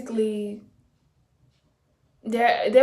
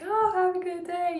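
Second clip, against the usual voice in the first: higher, sugary fake-polite goodbye; mock sweet farewell; 9 semitones higher than usual. Waving off former coworkers in a mock sweet voice. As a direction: sing it out sweetly, no care at all.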